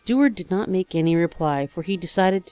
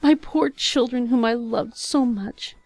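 grief-stricken plea; 7 semitones higher, 5 dB louder than usual. Acted. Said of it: "she cried".

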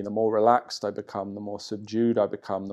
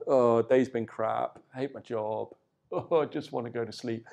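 softer, worn-out mock sigh of a tired worker; weary end of day; 4 dB quieter than usual. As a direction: deflate it like someone who hates their job. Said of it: "Qi down".

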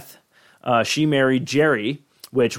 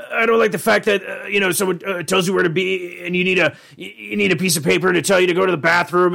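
Rick impression; 6 semitones higher than usual. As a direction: scoff at school rules. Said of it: "he's like".

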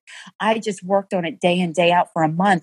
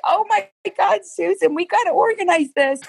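exasperated surprise; 12 semitones higher than usual. Retold or imitated. Overwhelmed by messy notes.

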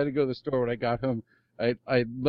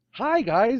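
high, louder cheerful greeting; future [name] voice; 12 semitones higher, 5 dB louder than usual. Acted. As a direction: greet the fans as a chirpy future self. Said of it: "Or future [name]".

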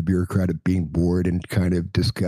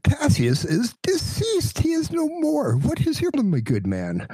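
high bureaucrat voice. Acted. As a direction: drag it out, high and fed up. Bureaucrat loses patience.

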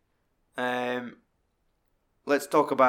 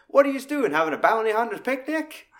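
high, louder mocking question; mocking jibe; 10 semitones higher, 4 dB louder than usual. Mannix mocking the bounty hunters, high teasing jibe. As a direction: tease them, pitched up. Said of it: "He's like".

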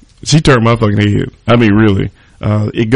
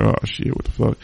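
muttered under breath; 7 dB quieter than usual. Retold or imitated.

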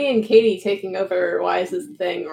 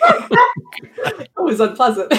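laughing; 7 semitones higher, much slower than usual. Real, not acted. Laughing at the sexy voice.

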